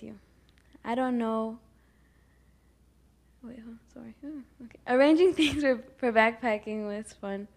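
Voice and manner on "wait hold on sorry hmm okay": quick whispered aside. Real, not acted. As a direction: drop to a whisper to sort yourself out. Aside while losing her place.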